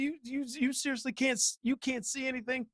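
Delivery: high, stammering incredulity; exasperated disbelief. Retold or imitated. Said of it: "I was like".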